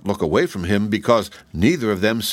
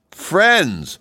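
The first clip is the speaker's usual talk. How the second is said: high exclamation; raised, high cry; exclaiming; 10 semitones higher, 4 dB louder than usual. A narrator's reading. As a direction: call it out, pitched well up. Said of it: "they all exclaimed".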